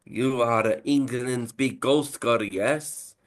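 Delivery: slow, lightly Italian mobster menace; Italian accent. Retold or imitated. Italian accent, mobster telling the striker to miss. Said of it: "it would be the same accent".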